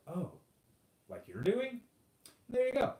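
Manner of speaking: high-pitched gotcha comeback; mock realization. Debater turning the accusation back on the opponent, mock realization.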